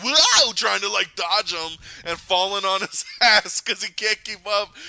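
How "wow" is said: loud, high yelp of surprise; sudden high wow; startled wow. Retold or imitated. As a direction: yelp it as if dodging. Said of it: "Flash is like".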